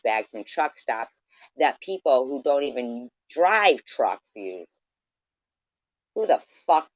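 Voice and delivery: loud, furious tirade; angry rant. Real, not acted. Vlogger rants loudly at woman who steals bags.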